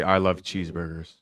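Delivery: American accent demo. Shown as a demonstration. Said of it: "give us your best American accent".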